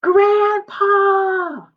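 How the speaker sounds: high, loud call across the house. Acted. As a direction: shout for Grandpa to come. Anna calls out for grandpa.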